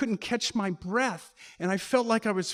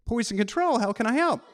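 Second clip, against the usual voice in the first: higher, louder brisk operator greeting; phone operator; 5 semitones higher, 6 dB louder than usual. Retold or imitated. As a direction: answer like a cheery hotline worker. Poison control operator answering.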